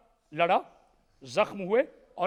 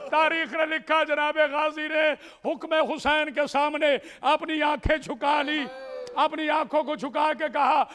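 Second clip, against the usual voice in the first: raised, ringing mourning-sermon delivery; higher, sustained lament-like recitation; impassioned majlis preaching; 5 semitones higher, 4 dB louder than usual. Acted. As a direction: preach the tragedy to a grieving crowd.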